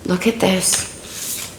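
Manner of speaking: slow, soft whisper. Real, not acted. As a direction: hush it, slowly, in awe. Awed at a drawn card.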